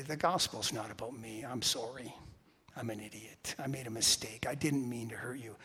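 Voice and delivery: low, soft, earnest confession; humble apology. Acted. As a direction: model saying sorry very quietly. Models an apology to someone.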